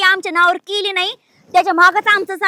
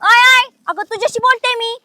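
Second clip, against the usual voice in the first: raised-voice accusing call; loud confrontation; 4 semitones higher, 5 dB louder than usual. Acted. Woman confronting in-law over chikoo fruit, loud and accusing. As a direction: call out sharply to challenge her. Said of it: "हळू बोल".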